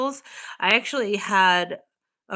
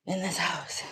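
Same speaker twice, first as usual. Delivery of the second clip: whisper; 6 dB quieter than usual. Real, not acted. Whispered about dark house.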